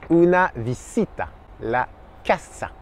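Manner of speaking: "visita" and "casa" with a hissing s; S sourd espagnol. Shown as a demonstration. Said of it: "uniquement se". (« visita », « casa » avec un s sifflant, jamais z)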